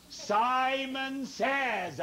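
high, very slow command; suspenseful, long-held high call; drawn-out game call. Pretend. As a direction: stretch each word to keep them waiting. Game leader stringing out the command.

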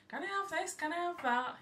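high, mimicking a shop customer; mocking a woman's whine. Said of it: "the woman behind me in Poundland were going".

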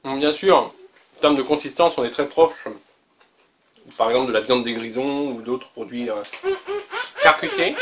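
talking while chewing; words through a mouthful of beef; mouth full. Real, not acted. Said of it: "on parle pas la bouche pleine".

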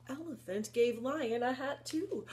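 ordinary delivery, nothing marked

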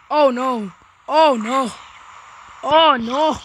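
loud, alarmed repeated cries; alarmed cry. Real, not acted. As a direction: cry out in mounting alarm at the mobs. Alarmed reaction to game mobs.

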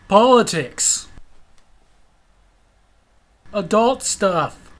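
slow, dragged-out mock boredom; eye-rolling mockery. Teen mocking a lecturing boyfriend, slow eye-rolling drone. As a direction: drawl each word like it bores you. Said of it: "I couldn't help but roll my eyes like".

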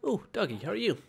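high, swooping pet-greeting voice; greeting a dog. Pretend. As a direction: coo a hello to a doggy.

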